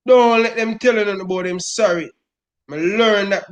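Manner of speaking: normal talking voice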